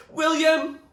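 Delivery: high, loud screamed name. Acted. Zoe screams to William over the noise, loud. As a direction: scream to be heard over battle. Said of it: "Zoe screamed again".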